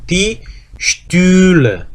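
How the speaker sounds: "Die Stühle" drawn out very slowly, rounded ü held; German ü, slow model; umlaut ü. Shown as a demonstration. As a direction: stretch out "Stühle" so the ü rings. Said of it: "umlaut가 붙고".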